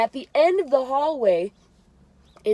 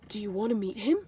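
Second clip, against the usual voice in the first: softer teasing offer; imitating Millie; 6 dB quieter, faster than usual. Millie offering to introduce her idol, imitated. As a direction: lean in with a hushed question. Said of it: "She goes like this".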